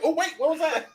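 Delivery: high flustered brain voice. Retold or imitated. Friend mocks her brain at the counter, high startled voice. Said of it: "her brain was like".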